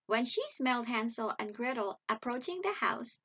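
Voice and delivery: ordinary delivery, nothing marked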